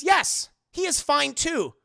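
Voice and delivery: high, loud, slow robotic answer; over-emphatic stilted robot yes; robot mayor. Acted. Robot mayor reassures Bruce about Jim Gordon, stilted and loud. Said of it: "Like Robo Mayor Hill".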